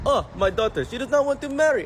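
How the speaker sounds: store owner's lament in a mock Arabic accent, pitched high. Comic voicing the store owner's worry about his daughter. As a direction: whine it like a worried father with an accent.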